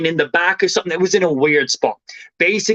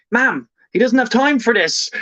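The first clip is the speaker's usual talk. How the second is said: high-pitched imitation of an impatient daughter; exasperated daughter; 8 semitones higher than usual. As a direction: cut in on a dawdling parent. Daughter cuts in on mother.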